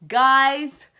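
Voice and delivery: loud, high call for attention. Real, not acted. Vlogger grabbing viewers' attention, loud high call.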